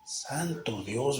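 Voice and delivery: slow whispered "my God"; barely audible, drawn-out whisper; hushed exclamation. Real, not acted. Hushed dismay at false preachers.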